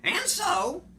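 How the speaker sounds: high, loud reproachful Lorax; scolding Lorax. Acted. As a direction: scold him in a high fussy voice. Lorax continuing his complaint.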